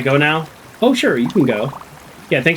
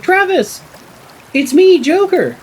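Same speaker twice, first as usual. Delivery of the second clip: put-on high-pitched eager call to an old friend; excited greeting; 4 dB louder, slower than usual. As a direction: re-enact a delighted hello, way up high.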